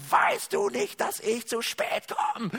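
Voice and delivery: hoch angebrüllt, gespielter Wutausbruch; brüllend. Retold or imitated. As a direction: die Frau hoch und laut anschnauzen. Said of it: "Und er brüllt sie an". (gespielt gebrüllt)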